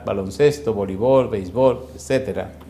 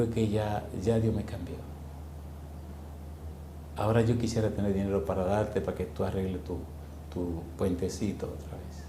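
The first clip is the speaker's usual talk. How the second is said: soft, choked-up words, much quieter; tearful; 6 semitones lower, 14 dB quieter than usual. Retold or imitated. Preacher retelling how he spoke to the injured boy while crying. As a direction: speak it through tears, barely above a breath. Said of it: "A mí se me salieron las lágrimas".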